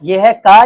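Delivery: का called out loud and firm; letter sound called out. Shown as a demonstration. Teacher to pupils, sounding out का loudly. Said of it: "teacher sounding out a letter".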